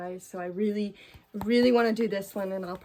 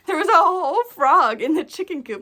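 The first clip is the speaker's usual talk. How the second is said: loud and higher, bursting with laughter; real laughter taking over the words; laughing; 7 semitones higher, 12 dB louder than usual. Real, not acted. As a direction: laugh your way through the reveal. Laughing at the frog story.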